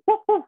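high yapping woof like a little dog; mock dog bark, pitched very high. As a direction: bark it out like a doggy. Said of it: "Kayak doggy tuh".

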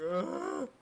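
high, loud wail; scared scream. Pretend. Player lost and scared in the game, wailing. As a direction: cry out loud and high in panic.

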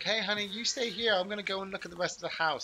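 mock excited housewife; excited wife. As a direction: chirp it like an excited wife heading off to explore. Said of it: "they're all excited".

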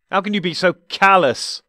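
high, loud furious outburst; loud enraged outburst; exploding. Acted. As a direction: explode at him in rage. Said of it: "the doctor exploded".

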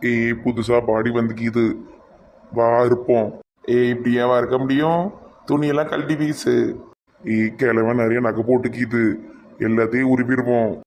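a hint of a sneaky robber; scheming mortuary thief, mildly played; lightly voiced thief. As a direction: thieves plotting over a body, lightly voiced. Thieves at the mortuary.

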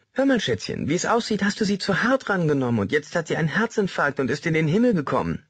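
leicht höher, gespielt mütterlicher Ton; Mutter nachgemacht. Retold or imitated. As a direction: eine Mutter halb im Scherz nachäffen. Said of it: "sie hätte gesagt". (Westerman äfft seine Mutter leicht höher nach)

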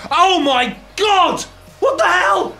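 appalled outburst at the mirror; shocked outrage. Acted. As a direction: yell it on seeing the ruined hair. Sees ruined haircut.

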